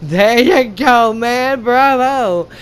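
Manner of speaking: drawn out, lightly put-on voice; slow mock praise. Viewer cheering the hero's kill, slow mock praise. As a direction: savour each word like a smug fan. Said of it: "I was like".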